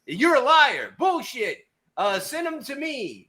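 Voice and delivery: high mocking voice of the critics. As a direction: parrot the doubters with scorn. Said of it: "When I said".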